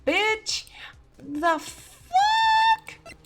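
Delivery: high, very loud, stretched-out shouting; exasperated yelling. Real, not acted. Streamer realizing they messed up the case, real exasperated shout. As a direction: yell it out high, climbing to a shriek.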